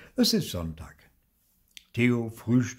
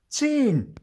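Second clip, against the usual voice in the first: put-on much higher, loud indignant child; 10 dB louder than usual. A narrator's reading. Theo indignant that Papa doesn't know, high and loud. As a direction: blurt it out outraged. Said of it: "Theo ist empört".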